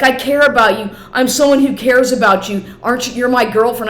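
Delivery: mocking his pleading. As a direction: mimic his clingy pleading.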